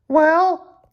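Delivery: put-on very high squeaky boy voice; high squeaky boy, slow on his name; squeaky David voice. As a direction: squeak it out like a cartoon kid. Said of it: "said David".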